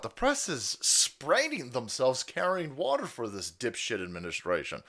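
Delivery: ordinary talk, nothing marked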